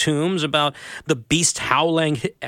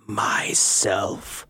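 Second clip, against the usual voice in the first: soft whisper, barely heard; 5 semitones lower, 4 dB quieter than usual. A narrator's reading. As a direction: breathe it like rustling leaves. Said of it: "whispering so softly you could just hear".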